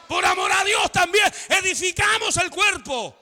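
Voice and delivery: high, loud, escalating pulpit shout; full-force preaching. Real, not acted. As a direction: build into a thunderous repeated declaration.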